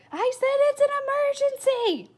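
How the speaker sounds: very high, loud yell; exasperated shout. Acted. Girl yelling at a rambling boy, shrill shout. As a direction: cut him off with a shriek. Flora yells at him.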